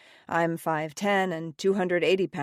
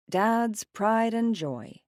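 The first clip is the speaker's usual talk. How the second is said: ordinary delivery, nothing marked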